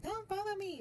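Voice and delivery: imitating tearful storming off. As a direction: retell her exit in a high, upset pitch. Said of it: "And then she was like".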